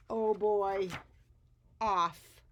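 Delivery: loud thrilled exclamation; excited. Acted. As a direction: cheer as he shoots off. Edwin bursts out and goes.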